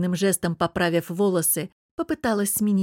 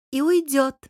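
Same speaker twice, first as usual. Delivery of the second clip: high, needling smirk; 7 semitones higher, 4 dB louder than usual. A narrator's reading. Anya taunts Bogdan that his wife will leave, smirking. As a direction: cap his words with a smug smirk. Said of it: "усмехнулась Аня".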